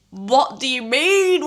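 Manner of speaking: slow, loud, high and incredulous; can't believe the news, drawn out; shocked delighted squeal; stunned disbelief. Real, not acted. Shock at marathon ballot win.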